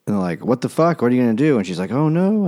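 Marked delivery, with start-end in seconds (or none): 0.4-1.6 higher, louder acted demand; mock outraged confrontation. Studio confronting her over naked photos, mock outrage. 1.9-2.4 much higher, small acted worry; mock dismay. Actress caught out by her photos, mock dismay. She reacts to being in trouble.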